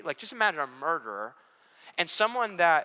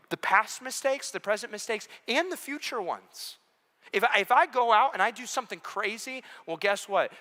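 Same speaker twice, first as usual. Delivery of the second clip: higher and louder, building preacher cry; rising preaching; 8 semitones higher, 6 dB louder than usual. Acted. Preacher to the room, building to a loud peak.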